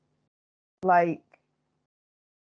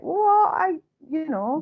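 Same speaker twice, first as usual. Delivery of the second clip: high, slow indignant what; hammed-up shocked disbelief; indignant old-school comic; mock outrage; 9 semitones higher, 5 dB louder, much slower than usual. Mocking older comic's indignation.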